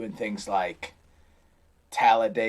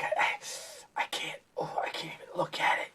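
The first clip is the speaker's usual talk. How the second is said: whisper; 5 semitones higher, 8 dB quieter than usual. Retold or imitated. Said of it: "He's like".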